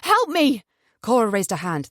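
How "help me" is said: high, loud cry for help; screaming. A narrator's reading. Trapped woman screaming for help at the top of her voice. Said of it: "Cora screamed at the top of her voice".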